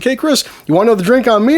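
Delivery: high, loud eager offer; outgoing friendly guy. Retold or imitated. Matt offering Chris another drink, high and loud. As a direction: pitch it up like a pushy drinking buddy. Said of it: "Very friendly guy, very outspoken".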